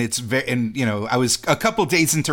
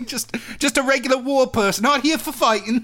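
high, prim English schoolboy; posh schoolboy voice; 10 semitones higher than usual. Pretend. Schoolboy offering lemonade to a soldier, high and prim. Acted skit at boarding school.

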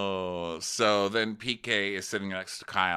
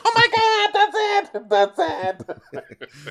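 much higher, loud gushing praise; thrilled squeal; 9 dB louder, much slower than usual. Retold or imitated. Imitating Kyle praising Harry's Brokaw. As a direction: gush like a thrilled fan. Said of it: "And Kyle's like".

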